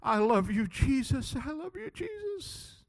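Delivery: high, thin tearful voice, fading away; acted sobbing devotion, very soft; imitating tearful crying. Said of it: "and he's crying".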